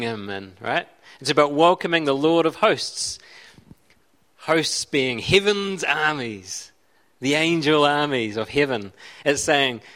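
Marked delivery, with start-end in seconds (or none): none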